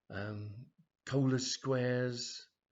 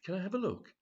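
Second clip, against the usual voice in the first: higher, coy request; coy customer; 9 semitones higher than usual. Acted. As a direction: ask sweetly to see more. Brenda asks to look.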